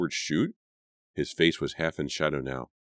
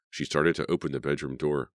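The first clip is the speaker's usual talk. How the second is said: ordinary delivery, nothing marked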